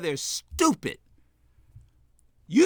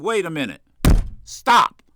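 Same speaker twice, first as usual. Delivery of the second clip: stern party-chairman rebuke, hard on "stop"; firm, no pussyfooting; 6 semitones lower than usual. Acted. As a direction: lay down the law, no hedging. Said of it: "I don't mean pussyfooting over it".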